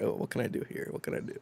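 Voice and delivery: whispered, plotting under the breath; scheming whisper. Retold or imitated. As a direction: murmur it like a sneaky plan. I'm like.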